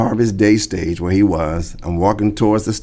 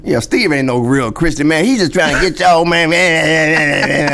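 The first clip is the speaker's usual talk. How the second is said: higher, louder mocking rant; a hammed-up bitter dig at Steve on stage; bitter mockery; 6 semitones higher, 3 dB louder than usual. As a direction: sneer it like a jealous heckler on the mic. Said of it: "I did all that craziness".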